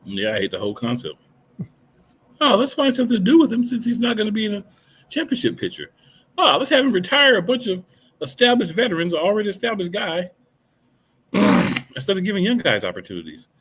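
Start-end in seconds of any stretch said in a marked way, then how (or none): 2.4-13.5 high, loud mocking voice; sarcastic mock planner. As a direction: pitch it up, ridiculing the bad idea. Sarcastic voicing of the bookers' reasoning.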